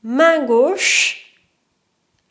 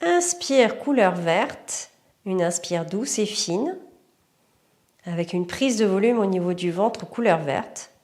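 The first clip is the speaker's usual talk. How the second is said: guided meditation; 3 semitones lower, 3 dB quieter than usual. Acted. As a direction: lead the breathing calmly. Guided breathing exercise.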